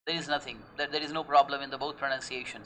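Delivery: ordinary talk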